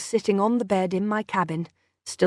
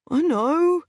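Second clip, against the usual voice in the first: distracted; 9 semitones higher than usual. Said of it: "he said distractedly".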